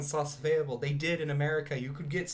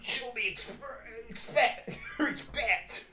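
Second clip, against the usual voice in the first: drunken, higher put-on cartoon voice; Rick; 10 semitones higher, slower than usual. Actor doing his drunk Rick voice to demand respect. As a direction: slur it out like a burping drunk. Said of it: "he's, like, doing Rick impressions".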